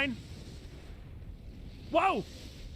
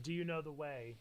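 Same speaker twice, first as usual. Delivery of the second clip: soft meme echo; 9 semitones lower, 14 dB quieter than usual. Retold or imitated. Echoing the meme line softly.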